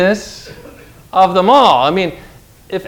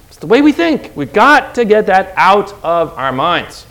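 ordinary talk, nothing marked

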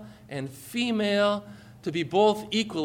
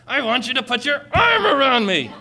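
high, loud comic outburst; mock exasperated yell; 7 semitones higher, 6 dB louder than usual. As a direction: imitate a fed-up wife yelling her demand. Acted quarrel, wife finally explodes.

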